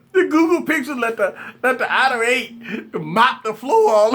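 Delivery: high-pitched laugh spilling into the words; can't stop laughing while trying to talk; laughing to tears. Real, not acted. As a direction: crack up mid-sentence, high and breaking. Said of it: "I'm up here crying, laughing".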